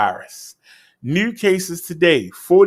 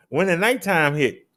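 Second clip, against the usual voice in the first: ordinary talk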